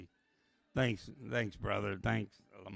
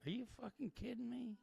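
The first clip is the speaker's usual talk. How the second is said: incredulous; 13 semitones higher, 9 dB quieter than usual. Retold or imitated. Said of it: "It's just like".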